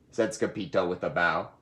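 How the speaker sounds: ordinary delivery, nothing marked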